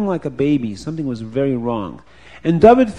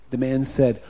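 ordinary delivery

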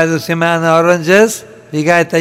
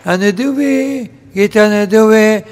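high, drawn-out cry for alms; beggar's wail; 6 semitones higher, 4 dB louder, much slower than usual. Acted. Beggar calling at the houses early morning, high wailing call. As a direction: sing out the begging call, stretching each phrase.

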